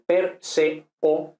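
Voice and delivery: "per, se, o" split into three separate syllables. Shown as a demonstration. Teacher splitting "per, se, o" into syllables for the class. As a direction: break "per, se, o" apart, one syllable at a time. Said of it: "son dos sílabas separadas".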